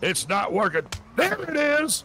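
loud, high revival proclamation; triumphant preacher. Pretend. Reverend declares the devil gone, loud triumphant preaching. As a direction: proclaim the miracle at full force.

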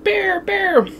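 mock scream the warning up high; retold screaming. Kids running to warn their mom, high panicked cry. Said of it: "We're screaming at my mom".

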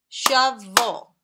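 "Shovel" broken into two loud beats; "Shovel" in two punched pieces; syllable by syllable. Shown as a demonstration. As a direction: say "Shovel" to a clap on each syllable. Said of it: "as we say them, let's clap".

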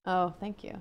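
normal talking voice

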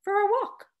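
high, pointed skeptical question. Acted. Mary doubting Kenneth's excuse for a walk, skeptical. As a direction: doubt every word of his excuse. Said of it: "my skepticism clear in my voice".

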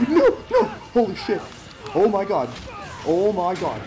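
terrified scream. Real, not acted. Fleeing again, screaming.

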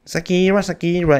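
a rough try at her throaty tone. Retold or imitated. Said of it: "no sé imitar bien el engole". (imitando el engole de [name], más agudo)

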